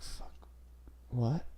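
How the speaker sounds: lightly imitated stoned confusion, soft; stoned, frozen kid. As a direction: trail into a quiet, baffled question after a freeze. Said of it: "paused for a second, and he froze".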